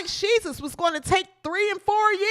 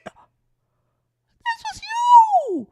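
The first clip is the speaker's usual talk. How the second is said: much higher, slow, breaking yell; cracking shout; 6 dB louder, much slower than usual. Real, not acted. Vlogger yelling at Shirley, voice cracking high. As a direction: hurl the accusation in a cracking cry.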